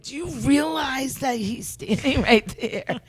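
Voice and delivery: high-pitched squeal breaking into laughter; starstruck disbelief. Retold or imitated. Fan telling Jess he's right there, starstruck disbelief. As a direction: gush it high, laughing at yourself. Starstruck, pressing her friend.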